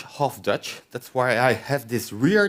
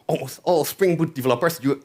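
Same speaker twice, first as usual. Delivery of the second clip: usual speaking voice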